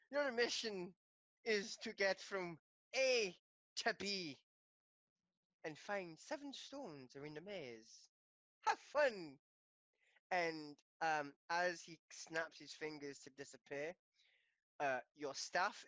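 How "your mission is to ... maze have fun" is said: put-on Irish-accented gnome giving a quest. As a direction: lay out the challenge in an Irish accent. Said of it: "he's Irish, actually".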